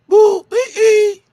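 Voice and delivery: put-on very high, loud baby squeal; mimicked baby babble. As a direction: imitate a baby's babbling in a piercing falsetto. Mimics the baby's babble from clip.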